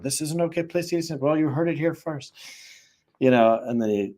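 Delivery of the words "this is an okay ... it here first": fast, higher mock TikTok host; hammed-up video host voice, quick and higher; mock presenter. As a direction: rattle it off to camera like a host. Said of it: "And he goes around the car and shows it".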